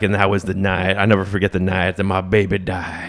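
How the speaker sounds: low country balladeer imitation; low mock-tragic country voice. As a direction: tell it like a dark, poetic country singer. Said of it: "sounds exactly like [name]".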